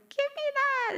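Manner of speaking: mock toddler demand. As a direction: squeal it like a greedy three-year-old. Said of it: "the three-year-old is like".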